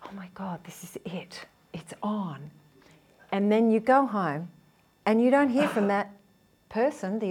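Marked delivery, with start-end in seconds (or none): 0.0-2.5 breathless whisper of secret excitement; mock inner voice, whispered and giddy; excited inner whisper. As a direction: whisper it like a thrilled secret thought. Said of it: "the anxious person's thinking".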